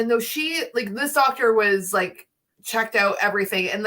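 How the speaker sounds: ordinary talk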